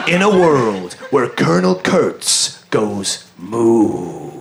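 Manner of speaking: slow, low trailer announcer; deep dramatic trailer read; movie trailer voice. Pretend. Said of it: "use the movie trailer voice".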